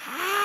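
high-pitched imitation of a metal singer's yell; mock metal scream. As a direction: squeal it like a rock singer. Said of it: "this guy was just shouting".

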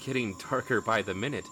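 soft, steady scene-setting over music; game master narration. A narrator's reading. Game master narrates to the table, hushed and steady. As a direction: draw the players into the moment.

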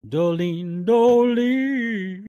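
high, loud drawn-out calling; sing-song calling. Pretend. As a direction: call the name out high and long. Calling out the starter's name.

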